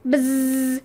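drawn-out, monotone buzz; buzzer imitation. Quiz contestant hitting the buzzer, imitated buzz.

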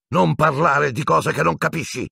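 high, angry rebuke; losing control. Acted. Snape shutting Hermione up, out of control. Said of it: "perdendo il controllo all'improvviso".

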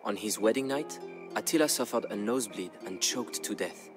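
ordinary delivery, nothing marked

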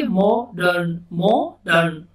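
मॉडर्न split as "mo ... dern"; syllable by syllable. Shown as a demonstration. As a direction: say मॉडर्न in two parts, mo, pause, dern. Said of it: "जोड़ जोड़ के बनाते हैं". (मॉडर्न को तोड़कर, मॉ ... डर्न)

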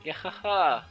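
loud acted villain cackle; villain laugh. Game villain taunts the heroes, loud acted cackle. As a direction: belt out a mock-evil "gahaha".

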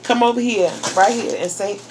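ordinary talk, nothing marked

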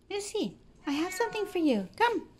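high, loud, fast coaxing call; calling the cat. Real, not acted. Owner calling her cat Missy for a treat, high coaxing call. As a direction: sing out to the cat to come.

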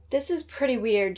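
plain reading voice

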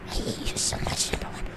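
breathy whispered threat, playful; hushed mock-menacing whisper; eerie whisper. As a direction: whisper it like a creepy demon. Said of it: "Stop with the demon voice".

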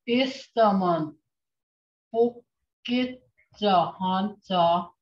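each syllable drawn out slowly for the class; slow teaching demo. Shown as a demonstration. Teacher slowly models the Muskogee phrase, clear and drawn out. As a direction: sound out Istamon Bokacha Honsa slowly for students to copy. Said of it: "Can anybody say that again?".

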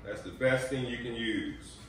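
ordinary delivery

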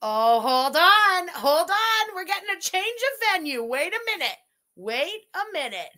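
loud at first, stretched and sing-song; hammed-up alarm. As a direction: make a big show of halting everything. Host announces sudden change on stream.

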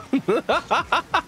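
high, delighted giggle. Real, not acted. Caster still laughing at the whiff, high giggle.

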